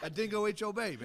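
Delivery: put-on comic Australian catchphrase accent. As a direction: call it out in a hammed-up Aussie accent. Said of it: "in your best Australian accent".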